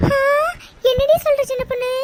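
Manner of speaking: high, loud disbelieving cry; shocked. Acted. Friend hears the sister-in-law is coming, loud shocked cry. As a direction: react like you can't believe it.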